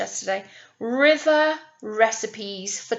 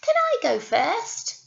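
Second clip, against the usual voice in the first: very high pleading little voice; baby goat begging to go first; little billy goat; 13 semitones higher, 7 dB louder, slower than usual. Acted. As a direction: beg like a small kid. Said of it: "said the little billy goat".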